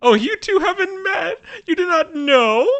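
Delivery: very high, theatrical gushing; delighted society lady. Acted. Lady inviting new acquaintances to dinner, high gushing voice.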